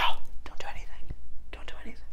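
breathy whisper, fading to nothing; whispered inner voice. Pretend. The empath's inner voice hushing them, whispered. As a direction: whisper it like a secret to yourself.

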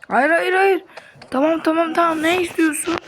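high, loud frantic begging; panicked pleading. Pretend. Hostage begging the captor, high panicked pleading. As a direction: beg him not to push you.

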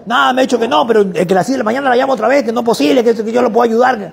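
put-on high, rushed, overeager young salesman; eager salesman. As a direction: pitch the shared taxi like a pushy go-getter. Student explains eagerly.

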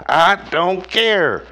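put-on sassy drawn-out kid voice; kid mimicking. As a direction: stretch it out like a defiant child. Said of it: "The kids is now running to school saying".

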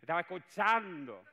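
high, loud laughing Chiquito voice; Chiquito impression. Boss repeating the reveal, laughing Chiquito-style voice. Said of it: "Chiquito, ¿no?".

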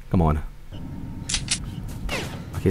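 normal talking voice

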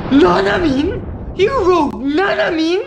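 gasping, stretched-out disbelief; dismayed cry. Acted. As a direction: wail the name in grief. Favorite character killed off.

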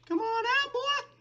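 very high squeaky coaxing call; high-pitched taunt. Pretend. Player coaxing the cornered wither, high-pitched taunt. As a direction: tease it out in a falsetto.

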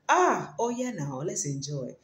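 ordinary talk

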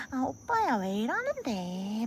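high, drawn-out cutesy whine; Gyeongsang aegyo. Acted. Gyeongsang girl to oppa, hammed-up cutesy pleading. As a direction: wheedle like a girl playing cute. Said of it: "경상도에서 애교 있는 애들은".

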